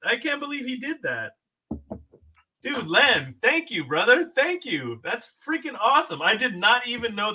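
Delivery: high, near tears and shaking; real tearful thanks, voice up high; teary gratitude. Real, not acted. A fan near tears thanking Len for the tribute. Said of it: "I got tears in my eyes right now".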